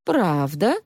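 usual speaking voice

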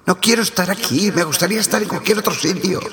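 high, fast, scared pleading; frightened crewman. Acted. Crewman drifting apart from the others, pleading in high fast fear. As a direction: rush it out in rising fear. Crewman panicking over radio.

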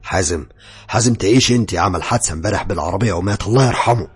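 loud, booming death announcement; thunderous voice. Acted. Man on the phone tells her Hazem died, thunderous voice. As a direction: thunder out the bad news, acted. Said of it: "قال لي بصوت زي الرعد".

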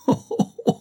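sudden high burst of laughter. A narrator's reading. Maria laughing at her jealous lover, bursting out. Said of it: "en éclatant de rire".

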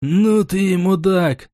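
slow, high-pitched contempt; spat-out insult. A narrator's reading. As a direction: spit the insult out, dragging it. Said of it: "сплюнул утрамбовщик".

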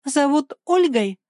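high, drawn-out mocking question; teasing incredulous echo. A narrator's reading. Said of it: "насмешливо переспросила Лиля".

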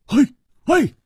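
high, agitated outburst; stamping impatience. A narrator's reading. Hu Tiehua feels left out of a fight, impatiently indignant. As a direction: fire it out in indignant bursts. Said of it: "胡铁花跺着脚说".

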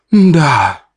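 languid, seductive reply; sultry languid voice. Acted. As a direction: breathe it out invitingly. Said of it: "томным голосом спросила она". (томным голосом)

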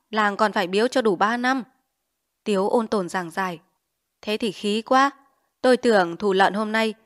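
plain reading voice

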